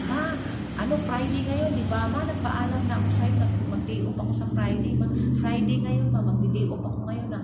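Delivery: loud, insistent reminder. Retold or imitated. Maid loudly reminds employer mother about Friday day off, insistent. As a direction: press Ma to remember the promised day off. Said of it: "Kailangan i-remind mo pa".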